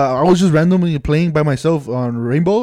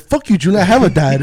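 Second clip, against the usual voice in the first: louder, higher mock defiance; mock outraged comeback; 4 semitones higher, 4 dB louder than usual. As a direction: imitate an indignant friend firing back, playfully. Imagined friend's angry comeback on podcast.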